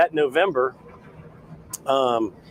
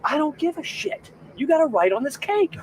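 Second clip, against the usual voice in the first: mock bossy wife; 13 semitones higher, 4 dB louder than usual. Boss's wife berates him, retold.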